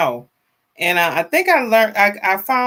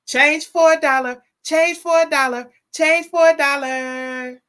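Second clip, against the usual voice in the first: sing-song greeting; 6 semitones higher than usual. Real, not acted. Host greeting chatter Change for a Dollar, high sing-song call. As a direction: chant the username out warmly, pitched up.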